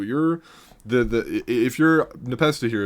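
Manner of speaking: usual speaking voice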